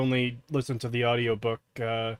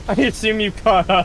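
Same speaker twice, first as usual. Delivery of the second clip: laughing; 7 semitones higher, 3 dB louder than usual. Real, not acted. Laughing after the scare.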